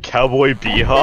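loud, slow, drawn-out shout. Real, not acted. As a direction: belt it out slowly.

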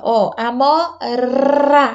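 amora said very slowly, letting the R sound; showing the trilled R. Shown as a demonstration. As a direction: stretch amora slowly so the child hears the R. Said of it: "já faz essa". (amora bem devagar, destacando o R)

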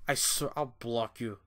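normal talking voice

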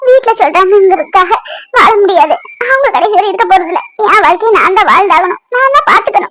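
anguished plea through tears; weepy, earnest praying; tearful prayer. Acted. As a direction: plead with God, voice catching with sobs.

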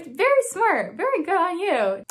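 high, loud exaggerated compliment; mock praise. Crediting the doctor who secretly observed her, exaggerated praise.

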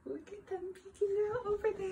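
soft and quick, like spotting them in secret; hushed peeking. A narrator's reading. To the children about the peeking animals, hushed. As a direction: point them out softly.

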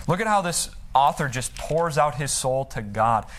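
usual speaking voice

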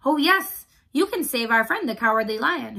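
loud, eager burst of an idea; quick reply. A narrator's reading. Scarecrow asks mice to save the lion, eager. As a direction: jump in with the sudden answer. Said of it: "said quickly".

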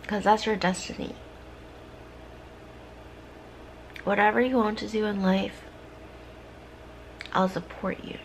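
soft, mostly unvoiced affirmations; ASMR whisper. To a viewer needing comfort, a soft ASMR whisper. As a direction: whisper close, like comforting a friend.

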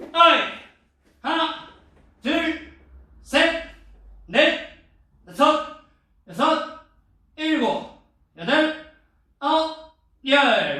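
loud, high drill count; shouted count. Acted. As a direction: bark out the count for the class.

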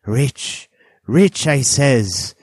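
loud, high, heated repetition; hot insistence. A narrator's reading. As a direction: burst out hotly, insisting on it. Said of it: "he repeated the statement hotly".

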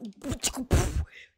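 loud mouth-made crack and puff; imitated whip noise. Streamer threatens whoever screams, with a mouth-made whip noise. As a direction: spit out a whip sound effect.

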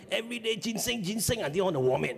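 high, disgusted whine; whining teen. Retold or imitated. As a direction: complain like a fed-up kid. Teen complaining about food, high.

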